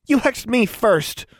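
high, slow, pointed accusation; accusing. A narrator's reading. Tomo blames Anna for hexing first, accusing. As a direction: throw the blame back in a high voice. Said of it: "Tomo accused".

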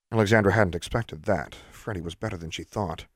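usual speaking voice

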